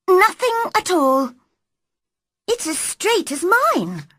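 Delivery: high-pitched, cross insistence; cross, childish. A narrator's reading. Girl insisting his back is straight, cross and childish. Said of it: "those crossly spoken, childish words".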